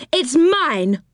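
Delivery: high, insistent claim; mild shout. A narrator's reading. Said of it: "shouted Moonface".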